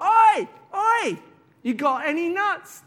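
loud, high oi oi; squirrel shouting. Acted. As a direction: bellow at the window, high and loud.

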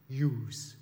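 attempted Scottish "yous", rough imitation; Glaswegian accent. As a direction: have a go at a Glasgow accent. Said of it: "He says it a bit like that".

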